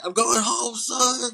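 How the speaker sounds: high, loud hyped-up yell; mock Wu-Tang member. Prisoner celebrating with [name], high mock hype yell. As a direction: whoop it like a hammed-up rapper. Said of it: "turns into a member of Wu-Tang Clan".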